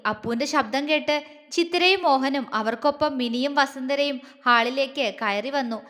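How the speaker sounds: ordinary delivery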